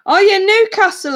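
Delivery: jumps up high, delighted surprise; surprised recognition. Real, not acted. Streamer reacts to a viewer from nearby, brightly surprised. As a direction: perk right up at the news. Viewer reveals she's from Newcastle.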